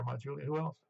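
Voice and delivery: ordinary talk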